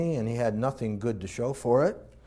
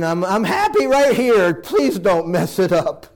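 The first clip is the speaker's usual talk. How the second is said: higher, louder desperate plea, slowing at the end; pleading; 5 semitones higher, 8 dB louder than usual. Retold or imitated. Young man pleading with God to leave his life alone, loud and desperate. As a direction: beg God not to change anything. Resisting God's call, pleading.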